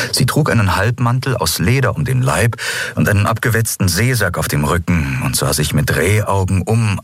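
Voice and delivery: plain reading voice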